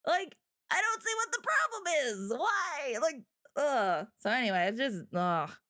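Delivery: fed-up rant sinking into a groan; real frustration, voice falling away; heated. Real, not acted. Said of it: "This gets me heated".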